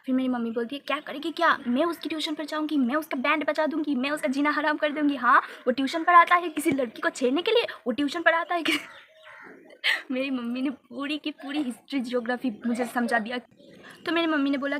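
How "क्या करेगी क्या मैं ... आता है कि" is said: loud, high imitated mom on a rant; mock furious rant. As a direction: rattle off mom's threats, raising voice. Mother ranting angrily, retold.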